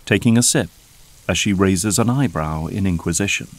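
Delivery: normal talking voice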